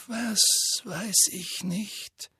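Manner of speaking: high, soft, slow calm question. A narrator's reading. Dumbledore calmly asks Harry what he doesn't know. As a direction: ask it quietly, unruffled. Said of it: "fragte Dumbledore ruhig".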